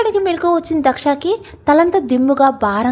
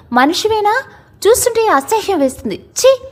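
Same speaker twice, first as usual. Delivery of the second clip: disgusted scolding; 6 semitones higher than usual. A narrator's reading. Wife scolded by furious husband.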